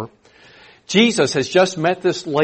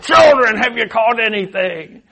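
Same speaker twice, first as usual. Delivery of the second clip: put-on old man voice; 9 semitones higher, 6 dB louder than usual. Jesus disguised calls to the disciples, as an old man. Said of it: "to be like an old man".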